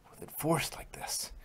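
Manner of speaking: soft unvoiced whisper; hushed warning. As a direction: drop to a whisper for the danger. Grave warning about the spell.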